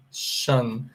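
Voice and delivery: "shan" as a drawn-out shun; sh sound demo. Shown as a demonstration. Teacher showing the ending after N, sh sound demo. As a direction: say "shan" with a long hushing sh, slowly. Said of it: "eu vou pronunciar o S I O N".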